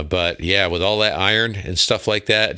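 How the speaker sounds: normal talking voice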